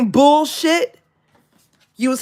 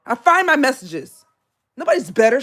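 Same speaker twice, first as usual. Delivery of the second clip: ordinary delivery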